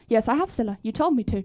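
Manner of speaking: timid quiet voice. Acted. The contestant Susan's voice.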